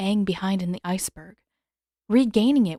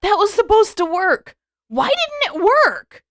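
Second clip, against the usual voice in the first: high, loud, disbelieving yell; shouting that it should have worked; frustrated shout; 13 semitones higher, 8 dB louder than usual. A narrator's reading. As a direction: demand an answer, outraged. Sokka's failed plan outburst.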